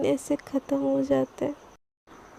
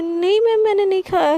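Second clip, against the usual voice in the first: blurted out higher and louder; flustered blurt; 5 semitones higher, 5 dB louder than usual. Acted. Sana caught by her boss: flustered, higher, louder blurt. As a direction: caught off guard, words slip out fast. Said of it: "ऐसे उसके मुँह से निकल जाता है".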